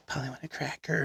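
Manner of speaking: soft retold whisper, barely voiced. Girl whispering behind him at the store, quiet whisper. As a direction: hush it like a stranger sneaking up. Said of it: "whispers behind me".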